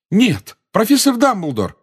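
high, loud plea; urgent cry. A narrator's reading. Harry begs Dumbledore not to confess, crying out. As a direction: call out loudly to stop him.

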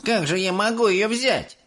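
put-on high, smirking kid's voice; a boy scoffing at the idea. A narrator's reading. Mike scoffing at Mr Wonka's order. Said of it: "усмехаясь, проговорил Майк Телек".

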